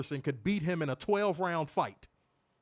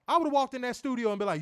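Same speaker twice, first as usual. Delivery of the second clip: normal talking voice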